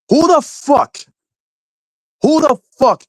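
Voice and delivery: high, loud exasperated outburst; angry rant. Real, not acted. Streamer ranting at chat about bad routers, high angry shout. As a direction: shout it at whoever bought this.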